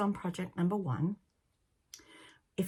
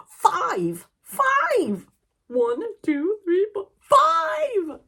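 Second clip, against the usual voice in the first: loud, very high exultant count; excited triumphant shout; 14 semitones higher, 11 dB louder, much slower than usual. Real, not acted. Podcaster revealing her count of finished objects, triumphant high shouting. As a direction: shout the number out, stretching the last one.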